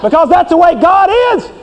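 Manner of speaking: high, scornful God driving out a sinner; angry holy God. Acted. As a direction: play an offended God ordering a sinner out. Preacher plays God rejecting sinner.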